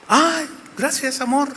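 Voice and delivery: high, soft, slow mock tenderness; sweet thanks. King thanks his wife, high and mock-sweet. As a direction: coo it like a spoiled husband.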